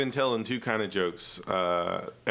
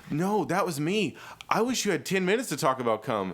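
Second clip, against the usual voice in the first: higher, lightly voiced woman; imitated older woman; 6 semitones higher than usual. Retelling what the woman said after the show, imitated woman. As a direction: lightly mimic a forward female fan. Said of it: "this woman who's in her forties comes up".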